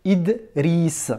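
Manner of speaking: Idriss split into Id, then riss with long vowel and S; sounded out. Shown as a demonstration. Teacher helps pupil hear each letter, saying Idriss in slow pieces. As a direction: break Idriss after the d, stretching the rest. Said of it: "tu l'entends quand tu dis Id".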